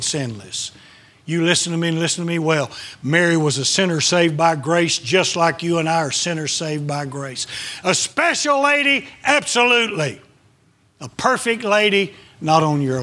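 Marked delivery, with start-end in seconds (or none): 1.2-2.7 fast warning. Real, not acted. Preacher commands attention. 7.8-12.1 much higher, ringing affirmation; emphatic. Real, not acted. Preacher insists to the congregation Mary was special, pitched high.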